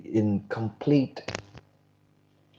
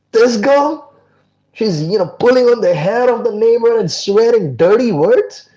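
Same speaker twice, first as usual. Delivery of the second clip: higher, louder indignant rant at a wasted trip; outraged disbelief; 8 semitones higher, 6 dB louder than usual. Acted. Kabir ranting that he walked all that way for this, indignant. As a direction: complain as if cheated out of a long walk.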